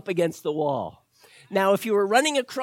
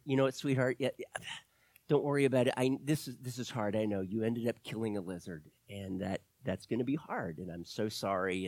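softer, gentle sympathy fading almost to a murmur; consoling; 7 semitones lower, 9 dB quieter, faster than usual. Retold or imitated. Husband consoling his upset wife, soft and gentle. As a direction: comfort her softly after the laugh. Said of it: "I tried to console her".

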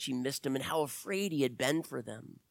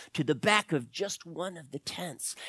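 plain reading voice

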